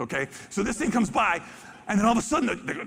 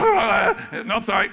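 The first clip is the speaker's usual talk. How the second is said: penguin voice; 6 dB louder than usual. Pretend. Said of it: "penguin's like".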